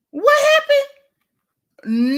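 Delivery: slow, high, loud wail of disbelief; shocked outcry. Real, not acted. Host reeling at a chat comment, a slow high loud cry of disbelief. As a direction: stretch it out like you can't believe it.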